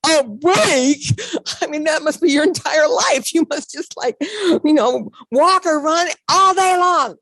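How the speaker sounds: high, loud astonished disbelief; incredulous. Retold or imitated. To the teammate who trains constantly, loud high disbelief. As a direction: voice shooting up in shocked amazement.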